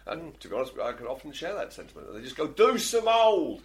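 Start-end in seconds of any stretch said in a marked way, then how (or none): 2.5-3.6 put-on slow, loud, high American-accented yell from the crowd; mock American heckle. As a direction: drawl it out like an American fan shouting. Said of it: "Except in an American accent".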